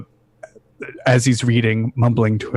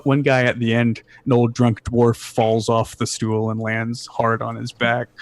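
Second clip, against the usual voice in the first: normal talking voice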